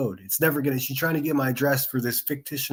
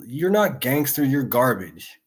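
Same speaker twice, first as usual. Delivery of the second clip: ordinary talk, nothing marked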